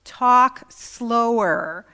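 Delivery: drawn-out, loud plea to slow down; slow, emphatic urging. Retold or imitated. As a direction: stretch each word slowly and loudly, as if coaching. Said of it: "constantly telling each other over those two weeks, "Talk slower".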